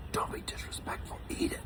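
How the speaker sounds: hushed, sharp whispered scolding; stern whisper. Retold or imitated. Friend hisses at him to eat up in a whisper. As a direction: whisper a warning not to be rude. Bri hushing him at a meal.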